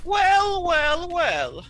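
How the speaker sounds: high, loud, sneering drawn-out wells; villain's entrance. Acted. Villain catches the heroes in his lair, gloating.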